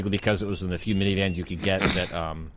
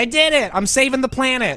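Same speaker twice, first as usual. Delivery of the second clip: high, loud mock celebration; triumphant boast; 5 dB louder than usual. Driver celebrating saving the planet, mock triumphant. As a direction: crow it proudly, pitched way up.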